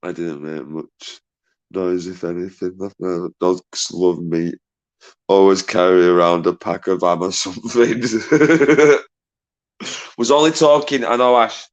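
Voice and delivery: usual speaking voice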